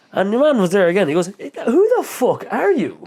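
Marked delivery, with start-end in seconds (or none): 1.4-3.0 high, drawn-out imitation of a challenge; hammed-up puzzled stranger, pitched high; mock incredulous demand. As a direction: demand it in exaggerated bafflement.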